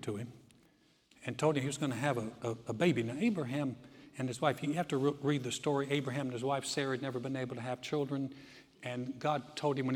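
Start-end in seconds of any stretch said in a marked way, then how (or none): none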